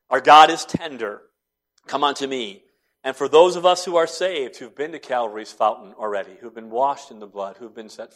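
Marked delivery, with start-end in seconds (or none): none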